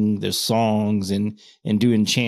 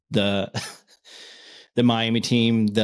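normal talking voice

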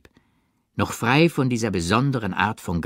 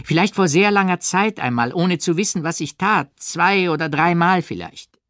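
heated admission; 10 semitones higher, 4 dB louder than usual. A narrator's reading. Odette's reluctant confession.

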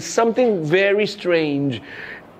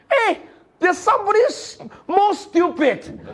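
put-on gloating kids; 13 semitones higher than usual. Kids gloat over new boy.

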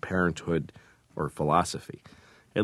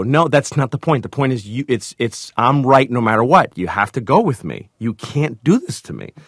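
loud, higher, insistent re-enactment; exasperated husband; 5 semitones higher, 5 dB louder than usual. Retold or imitated. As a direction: argue your case to your wife. Retelling argument with his wife.

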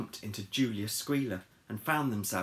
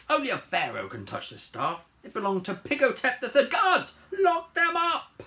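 building to a high, loud command; imperious fury; 14 semitones higher, 7 dB louder than usual. Acted. Cleopatra ordering guards onto the pigs, mounting rage.

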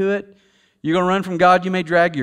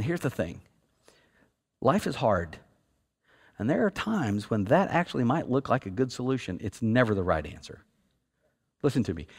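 lower, softer and slowed, sincere; earnest soft aside; 5 semitones lower, 4 dB quieter than usual. Real, not acted. Preacher gently telling hurting listeners there's hope. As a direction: speak gently to anyone hurting. Tender aside about suicide.

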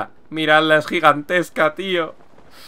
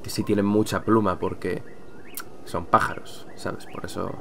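low, very soft explanation; hushed, low-pitched mumble; muttered aside; 6 semitones lower, 14 dB quieter than usual. Real, not acted. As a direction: trail through it under your breath. Sheepish aside explaining a pun.

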